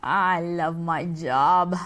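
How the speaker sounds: slow, low smug pleasure; smug gloating. Acted. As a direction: savour it like a villain. Devil gloating.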